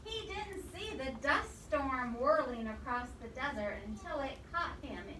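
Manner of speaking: ordinary talk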